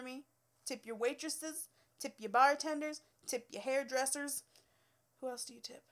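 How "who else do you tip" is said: whispered aside. Real, not acted. Aside to herself.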